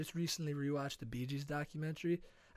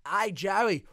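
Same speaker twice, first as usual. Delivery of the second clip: higher, louder put-on Australian; Australian accent; 4 semitones higher, 6 dB louder than usual. Bandmate confiding in [name], in mock Australian accent. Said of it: "or, like, Australian, like the Bee Gees".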